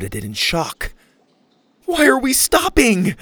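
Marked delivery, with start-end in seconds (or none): 1.8-3.1 loud, high frightened question; panicked cry. Acted. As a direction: cry out, scared. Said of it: "Lainey cried".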